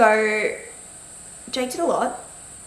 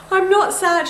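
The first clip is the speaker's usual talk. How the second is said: high, louder retold denial; insistent protest; 11 semitones higher, 6 dB louder, slower than usual. Mum answering her daughter, insistent protest. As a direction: re-enact protesting to a small child.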